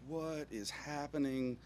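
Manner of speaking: slow, hushed bewilderment; dumbfounded, quiet and slow; stunned disbelief. Retold or imitated. Stunned at shirt.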